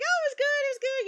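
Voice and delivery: high light easygoing answer; mock relaxed reply; casual reply. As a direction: toss it off breezily. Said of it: "he's like".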